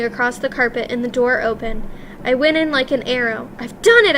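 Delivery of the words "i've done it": high, loud triumphant cry; excited cry. A narrator's reading. Boy-mouse crying out in triumph to his grandmother. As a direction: burst out with the victory. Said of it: "I cried".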